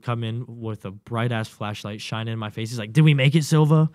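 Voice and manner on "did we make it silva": loud, high mocking question; taunting drill instructor. Instructor checks every five minutes, loud taunting question. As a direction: call it out to taunt.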